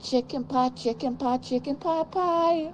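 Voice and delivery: very high, slow silly voice; David Cross impression. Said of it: "David Cross".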